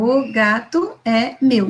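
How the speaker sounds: O gato é meu read slowly, word by word; slow child reading. Shown as a demonstration. Teacher imitating a child pointing at each word, slow. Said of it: "A criança vai com o dedinho".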